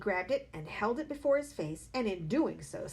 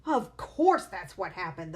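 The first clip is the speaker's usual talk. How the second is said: louder certain cry; 8 dB louder than usual. A narrator's reading. Mother crying out in triumph, louder. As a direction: pounce on it. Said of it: "the mother cried".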